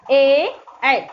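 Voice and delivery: ए then ऐ, said clearly and firmly; ए and ऐ demo. Shown as a demonstration. Teacher contrasts ए and ऐ for confused pupils. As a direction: contrast ए with ऐ from throat and palate. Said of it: "देखिए जोर कहाँ से पड़ रहा है".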